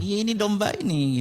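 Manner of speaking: high-pitched eager mock exclamation. To himself, spotting a stray sheep, eagerly. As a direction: voice leaps up, as if spotting a find.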